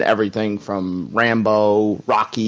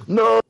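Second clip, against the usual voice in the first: high guttural Rocky yell; Stallone yell imitation; 11 semitones higher than usual. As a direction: cry out No like Rocky after Mickey dies. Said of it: "I was doing the, uh, Sylvester Stallone".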